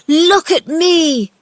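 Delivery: high, loud, slow call; triumphant shout. A narrator's reading. Boy standing, shouting up at the gardener, triumphant. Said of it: "he shouted up at Ben".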